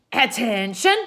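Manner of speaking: loud barked command; girl shouting orders at a coffee party; piercing cry. A narrator's reading. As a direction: shout it like a drill sergeant. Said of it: "a piercing cry came from the hall".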